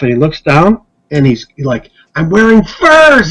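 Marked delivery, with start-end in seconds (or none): 2.1-3.3 high, slow and amazed; excited. Retold or imitated. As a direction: discover the furs with delight. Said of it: "He's getting more excited".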